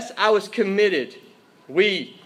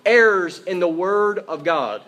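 slow, forceful declaration; emphatic; 4 dB louder than usual. Real, not acted. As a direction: declare it firmly, drawing out the words. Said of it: "We say this emphatically".

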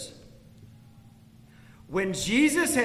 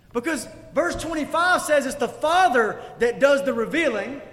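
higher, louder pressing questions; fervent preaching; 7 semitones higher, 5 dB louder than usual. Acted. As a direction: challenge the listeners with each question. Passionate questioning in sermon.

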